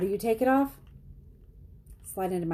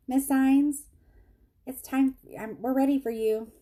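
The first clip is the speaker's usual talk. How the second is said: high, louder summons across a waiting room; calling a patient; 9 semitones higher, 3 dB louder than usual. Retold or imitated. Clinic worker fetching her from the waiting room, calling out. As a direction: imitate staff calling the next patient.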